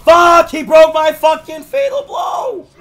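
raging yell at the game; furious shout. Real, not acted. Gamer yelling at his opponent in fury. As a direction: scream it out in real fury. Losing a ranked fight, raging.

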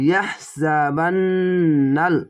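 very slow, elongating each vowel; slow tajweed recitation of ইয়াহসাবান্নাল; slow drawn-out recitation. Shown as a demonstration. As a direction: stretch the word out as in Quran reading. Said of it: "এক আলিফ টেনে পড়বেন".

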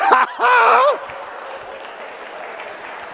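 real laugh, high and airy; high breathy laugh. Real, not acted. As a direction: break into a wheezing laugh. Laughing at own story.

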